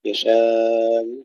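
يشاء drawn moderately, final hamza softened and barely voiced; medium length with eased hamza. Shown as a demonstration. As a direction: ease the hamza at the end of يشاء. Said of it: "الوجه الرابع لهشام". (تسهيل الهمزة بالروم في يشاء)

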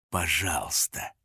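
low, slow, quiet menace; through clenched teeth. A narrator's reading. Hunter adds politeness grudgingly, teeth clenched. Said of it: "сквозь зубы добавил".

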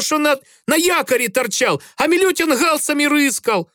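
put-on high, heated drunk sailor rant; shouting match. A narrator's reading. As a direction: yell back at the officer. Грицай shouts back at officer. (кричит в ответ)

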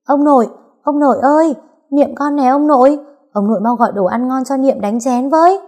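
put-on high, loud, gleeful child calling Grandpa; joyful calling out. A narrator's reading. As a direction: call out eagerly to a beloved grandfather. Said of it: "mừng rỡ gọi ầm ĩ".

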